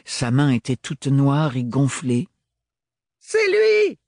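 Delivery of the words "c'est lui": much higher, louder outcry; exclaiming. Acted. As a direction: point and cry out. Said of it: "s'exclama quelqu'un".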